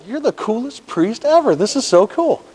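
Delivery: high-pitched, delighted gushing; delighted amazement. Retold or imitated. Driver to the swearing priest, delighted amazement. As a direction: gush in a high voice, retelling the delight.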